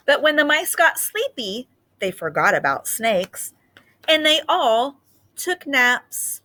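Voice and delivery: put-on lively read-aloud for young children; story reading. Teacher reading a picture book to students, lively storytelling.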